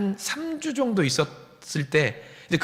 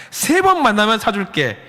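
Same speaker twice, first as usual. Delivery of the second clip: playful promise; 5 semitones higher, 6 dB louder than usual. Retold or imitated. Quote repeated.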